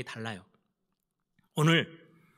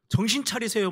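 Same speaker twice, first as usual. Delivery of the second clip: mock rebuke; 6 semitones higher than usual. Peter scolding Jesus, raised rebuking voice. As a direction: shake him awake with a raised voice. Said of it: "큰 소리 치는 거예요".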